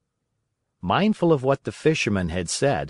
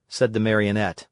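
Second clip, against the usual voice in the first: plain reading voice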